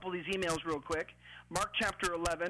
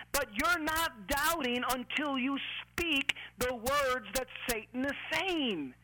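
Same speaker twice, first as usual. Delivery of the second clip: raised, fired-up pulpit preaching; 5 semitones higher than usual. Acted. Pastor urging his listeners not to doubt, preaching at full force. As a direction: drive it home like a revival preacher.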